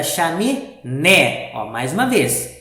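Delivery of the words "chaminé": "chaminé" broken up with the NÉ hit loud; stress on last syllable. Shown as a demonstration. Teacher reading the story sentence, stressed last syllable. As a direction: punch the final "né" of "chaminé". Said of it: "eu vou ler a última".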